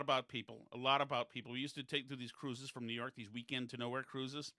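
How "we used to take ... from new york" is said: ordinary delivery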